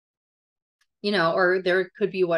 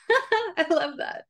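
laughing; 10 semitones higher, slower than usual. Real, not acted. Laughing delight at the quote.

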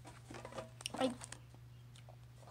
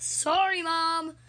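put-upon apology; 3 semitones higher, 6 dB louder, much slower than usual. Pretend. Scolded rooster answers mom.

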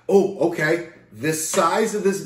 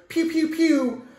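gun noises; 12 semitones higher than usual. Pretend. Imitating gunshots from bottle.

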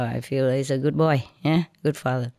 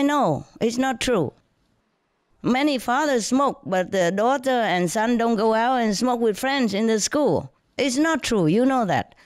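retold firm contradiction; 7 semitones higher than usual. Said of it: "I continue my lecture".

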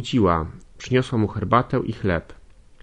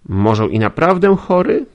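a bossy servant prodding him; servant woman's voice; 8 semitones higher, 8 dB louder than usual. Acted. Nastazja questioning the sick young man, high and loud.

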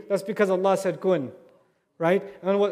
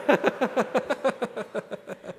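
soft laugh; 3 semitones higher, 3 dB quieter than usual. Real, not acted. Laughs after crying remark.

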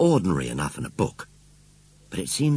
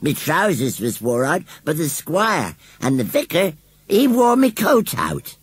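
high, slow rustic scarecrow boasting; proud; 9 semitones higher, 5 dB louder than usual. A narrator's reading. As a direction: build to a high, loud boast. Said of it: "explained Gummidge proudly".

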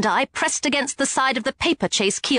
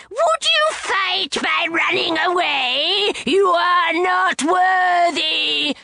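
scornful shout; 5 semitones higher, much slower than usual. A narrator's reading. Neckbet taunting Sadie in flight.

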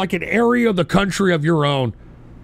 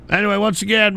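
normal talking voice

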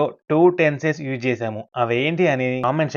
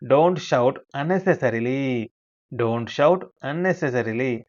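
slow model sentence; much slower than usual. Shown as a demonstration. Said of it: "అలాంటి సందర్భాలలో".

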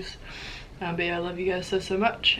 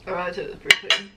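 ordinary talk

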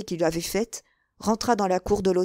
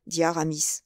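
usual speaking voice

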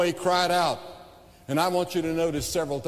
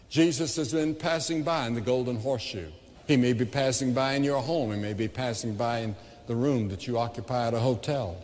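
usual speaking voice

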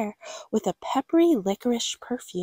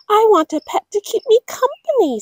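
put-on high, louder girl voice; child's voice; 11 semitones higher, 5 dB louder, faster than usual. Girl telling parents what she wants, high pleading. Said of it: "said Gwendolyn".

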